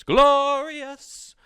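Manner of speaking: high, loud, held-out cry; hammed-up exultation. Comedian rejoicing at no bugs, in a high exultant cry. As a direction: belt it out in mock rapture. Exultant outburst about fall.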